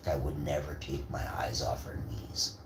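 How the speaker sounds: ordinary talk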